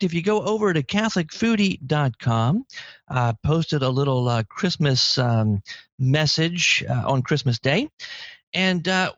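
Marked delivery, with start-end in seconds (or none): none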